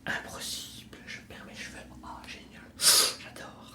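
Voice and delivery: very soft, voiceless whisper; whispered aside. Acted. Introducing the channel to viewers, secretive whisper. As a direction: whisper it under your breath.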